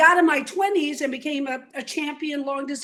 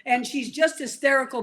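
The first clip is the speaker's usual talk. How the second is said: normal talking voice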